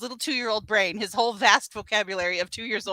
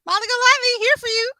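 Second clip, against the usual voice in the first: higher, louder pushy sales voice; hammed-up life coach; 11 semitones higher, 7 dB louder than usual. Mocking Molly offering to fix Johnny's life, loud. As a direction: pitch your help eagerly.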